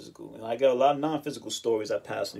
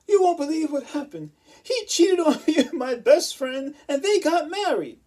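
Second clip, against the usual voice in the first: much higher, louder put-on girly voice; imitating ex-girlfriend; 8 dB louder than usual. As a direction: whine out the betrayal story. Ex-girlfriend telling of being cheated.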